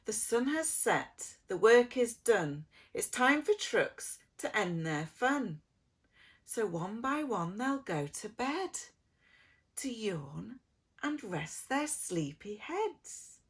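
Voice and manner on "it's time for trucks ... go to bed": plain reading voice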